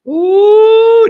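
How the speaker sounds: loud high hype whoop; high excited whoop. Acted. Host hyping the show with a loud high ooh. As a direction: let out a big high ooh.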